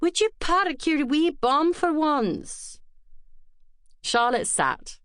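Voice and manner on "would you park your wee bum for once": hammed-up Scottish burr calling out; Scottish accent. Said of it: "her Scottish burr exaggerated by the rolling of the R".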